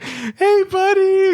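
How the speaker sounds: very high, mock-sweet greeting; gushing buddy greeting. [name] mocking the boss greeting a late employee, gushing. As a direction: coo it like pinching someone's cheeks. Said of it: "he's gonna be like".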